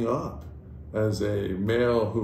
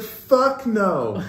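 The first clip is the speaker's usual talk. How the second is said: high-pitched put-on son's refusal; sassy son; 8 semitones higher, 3 dB louder than usual. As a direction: refuse dad in a high, drawn-out voice. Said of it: "He's like".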